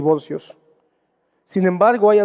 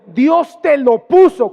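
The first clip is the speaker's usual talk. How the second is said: higher, louder, slow tempting urge; insistent tempting; 6 semitones higher, 6 dB louder, slower than usual. Retold or imitated. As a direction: press him hard, slowly, like a follower egging him on. Followers tempting David to kill. (seguidores tentando a David: insistente y lento)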